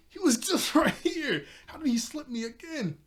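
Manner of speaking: high, loud comic disbelief, starting through a laugh; mock exasperated outburst. [name] loses sight of someone right here, mock exasperation.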